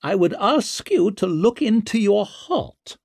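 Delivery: high-pitched insistent noblewoman; imperious entreaty. A narrator's reading. Mother asking the judge to look into his heart, imperious. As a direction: appeal to him with haughty pressure.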